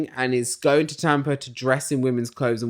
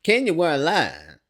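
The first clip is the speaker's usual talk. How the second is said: exaggerated country accent, pitched up; Candy; 7 semitones higher, 3 dB louder than usual. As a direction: put on a high country accent. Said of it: "Candy gives us real country accent".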